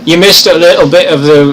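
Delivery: host filling in a late viewer; emphatic. Real, not acted. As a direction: tell him what he missed. Telling viewer he missed reading.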